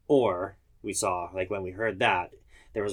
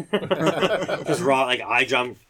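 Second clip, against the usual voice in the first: talking through laughter, higher and louder; laughing; 5 semitones higher, 6 dB louder, much slower than usual. Real, not acted. Firefighter telling about jumping out, laughing. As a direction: laugh while carrying on the story. Laughing after joking hydrant footage request.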